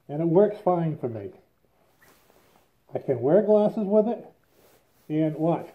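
muffled behind mask; speaking inside respirator; through a respirator mask. Real, not acted. Seen 1: Half-face respirator with filters worn over mouth throughout the words.

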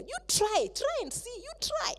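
high, coaxing tempter's voice; tempting Satan. Acted. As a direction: egg her on like the sly tempter.

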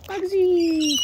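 high, loud sing-song call; calling the bird. Real, not acted.

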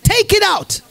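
emphatic repeat. Real, not acted. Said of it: "You've got to take it out".

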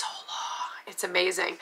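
hushed aside, breathy and slow; confiding whisper. Real, not acted. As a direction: lean in and whisper it. Aside about denture fit price.

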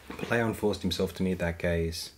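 plain reading voice

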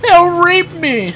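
high, slow, loud panicked wail; panicked shriek. Real, not acted. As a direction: shriek it in mock terror. Panicking under fire in game.

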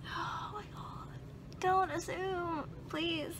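slow, high breathy whisper of disbelief; exasperated whisper. Retold or imitated. Retelling a pleading reply to her friend, in a slow high whisper. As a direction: whisper it like you can't believe what you just heard. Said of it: "And I'm like".

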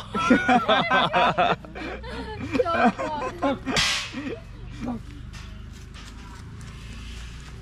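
helpless laugh after a sour bite; laughing at the sourness. Real, not acted. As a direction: laugh through the sour shock. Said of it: "wow, that hit me".

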